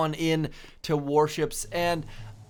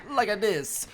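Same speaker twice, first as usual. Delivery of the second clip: higher-pitched mock Italian accent; imitation Italian accent, pitched up; fake Italian accent; 4 semitones higher than usual. Shown as a demonstration. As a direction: playfully put on a bad Italian accent. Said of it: "trying to do a fake Italian accent".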